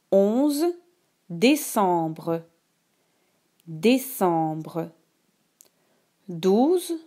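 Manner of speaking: slow, clear dictation voice; dictating. Shown as a demonstration. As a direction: read each word slowly for dictation. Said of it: "Écoute attentivement la dictée".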